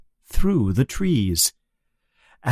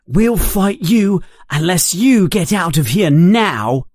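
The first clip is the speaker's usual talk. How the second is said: higher, louder schoolboy threat; defiant threat; 7 semitones higher, 4 dB louder than usual. Acted. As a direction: stand up to the bully. Harry confronting Malfoy.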